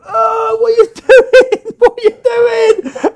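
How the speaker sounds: put-on high, slow, loud shocked girl's voice; mock shocked schoolgirl. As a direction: play the outraged girl, squealing it out. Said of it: "she'd go".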